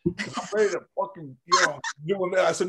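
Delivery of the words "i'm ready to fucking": ordinary talk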